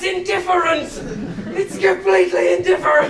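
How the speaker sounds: high, excited laughing shout. Acted. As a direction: blurt it out, laughing. Excited improv outburst.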